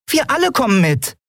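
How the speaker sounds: high, thin little squeak of a voice; piping. A narrator's reading. Small companion chiming in eagerly, high piping voice. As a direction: pipe it up high like a tiny creature. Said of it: "piepste Nelen".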